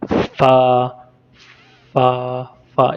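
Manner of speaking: फ़ा with lip on teeth; Arabic letter. Shown as a demonstration. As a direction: breathe फ़ा through the teeth. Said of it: "Arabic ज़ुबान में इसको फ़ा कहते हैं". (फ़ा होंठ दांत पर रखकर)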